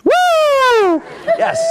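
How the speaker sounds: high, loud whoop of excitement; excited cheer. Real, not acted. Coach whooping back at the cheering audience, excited and loud. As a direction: cheer it right back at the room.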